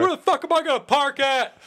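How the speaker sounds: high, exaggerated whining voice; mocking whiner. Mocking a neighbor at the sewer meeting, high whine. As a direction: whine it up high like a silly complainer. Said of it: "Where do I park?".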